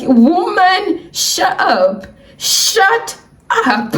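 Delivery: high, exasperated mock outburst; exasperated retort. Silent retort to the cheerful pharmacist, mock exasperated. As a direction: draw it out in comic exasperation.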